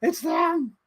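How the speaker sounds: high excited cry; mock yell. Retelling the cameo in the theater, high excited cry. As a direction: blurt it out at the screen.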